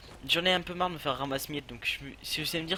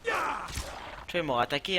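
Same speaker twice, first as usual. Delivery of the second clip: excited; 5 semitones higher than usual. Real, not acted. Wolf attacks while playing.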